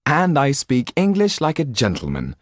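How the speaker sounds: teasing pride; lightly voiced proud young man. Jan's voice.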